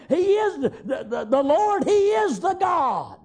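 high, slow, ringing cry of he is the God; proclaiming shout. Acted. A servant retelling the fire to Jezebel, shouting slowly. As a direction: proclaim it like the whole crowd falling down. Retold crowd proclaiming the Lord.

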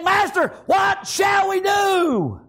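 high, slow shouted cry; shouted plea. Acted. Servant shouts to his master, not calm at all. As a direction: cry it out in desperation. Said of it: "He didn't say".

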